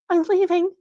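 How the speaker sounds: ordinary delivery, nothing marked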